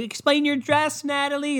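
put-on high-pitched girly interviewer voice; hammed-up female red-carpet host; mock red-carpet interviewer. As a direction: call it out like a gushy E reporter. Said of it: "It's always a fucking girl".

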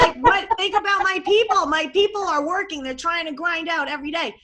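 excited. Real, not acted. Excited pitch.

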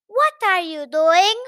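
slow, loud, high demand; confronting chaperone. Acted. Chaperone catching the narrator with the headmistress, loud demand. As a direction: bellow the question slowly.

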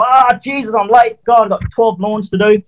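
panicked waking. Retold or imitated. Retold panicked oversleeping.

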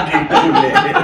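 said through loud laughter; laughing hard while talking. Real, not acted. Laughing at friend's crude joke.

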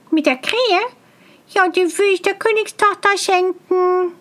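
high, eager bumpkin voice; excited simpleton. A narrator's reading. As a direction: show off your find, high and proud. Tölpelhans answers brightly.

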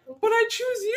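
high, hammy romantic plea; dramatic declaration. Retold or imitated. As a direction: play it like a movie heroine. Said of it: "And I was like".

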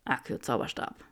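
whispered murmur under breath. A narrator's reading. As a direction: murmur the spell. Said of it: "und murmelte".

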